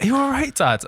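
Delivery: high, lightly voiced kid's question; imitated child checking on Dad; concerned child. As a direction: ask like a worried kid. Child checks on hungover father.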